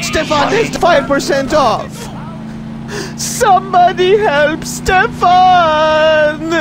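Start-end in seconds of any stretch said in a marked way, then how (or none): 3.2-6.5 long, drawn-out crying plea; wailing for help. Acted. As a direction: cry out slowly for rescue. Threatened man cries for help.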